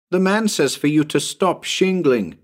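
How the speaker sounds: put-on high child's voice relaying a message; little boy. Tommy passes a message up the roof, high boy voice.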